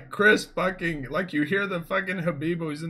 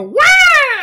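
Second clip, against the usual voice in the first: high, loud parrot screech; bird squawk; 11 dB louder than usual. Retold or imitated. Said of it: "you hear the bird go".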